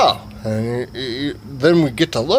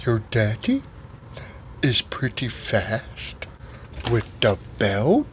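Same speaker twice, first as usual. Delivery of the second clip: slow low voice; 4 semitones lower, 8 dB quieter, slower than usual. Retold or imitated.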